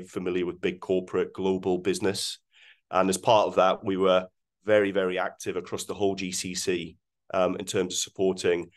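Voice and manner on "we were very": ordinary talk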